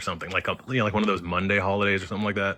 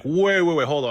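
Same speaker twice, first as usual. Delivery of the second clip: urgent wait; 8 semitones higher, 5 dB louder than usual. Retold or imitated. Stopping the yelling relatives.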